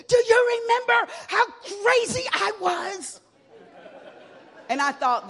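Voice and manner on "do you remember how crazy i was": much higher, louder, laughing mom; fierce mother. Retold or imitated. Mother pinning daughter to cage, high and fierce. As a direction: grab her and demand it, high.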